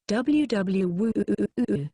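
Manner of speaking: slow, marvelling wooo; like discovering new ruins; amazed drawn-out woo. A narrator's reading. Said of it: "she sounded like an archaeologist who had just discovered".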